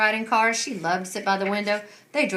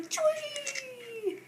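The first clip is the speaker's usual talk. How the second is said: flying whoop; 5 dB quieter than usual. Acted. Flying off the slingshot.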